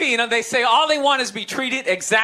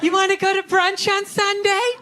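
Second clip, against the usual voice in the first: mock girlfriend; 5 semitones higher than usual. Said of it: "they'll be like".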